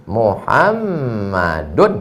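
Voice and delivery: drawn-out Muhammadun with a clear final -un; slow teacher's demo of Muhammadun; Arabic case ending. Shown as a demonstration. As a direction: stretch Muhammadun slowly, sounding the -un ending.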